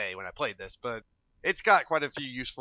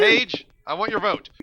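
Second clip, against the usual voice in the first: raised voice calling a viewer; 7 semitones higher, 9 dB louder than usual. Real, not acted. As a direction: call out to her loudly. Calling out to subscriber.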